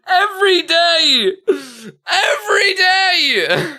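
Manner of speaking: high wailing laugh-shout, drawn out slowly; crying with laughter. Real, not acted. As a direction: wail it through tears of laughter. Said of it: "Oh, God, I'm crying".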